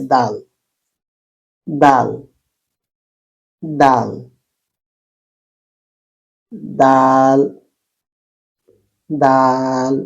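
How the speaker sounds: দাল repeated slowly and lightly, not heavy; easy, unforced দাল each time; light Arabic dal. Shown as a demonstration. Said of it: "এত মোটা বা ভারী কষ্ট করে বলার কিছু নেই". (হালকা, সহজ দাল)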